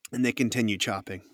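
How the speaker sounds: ordinary talk, nothing marked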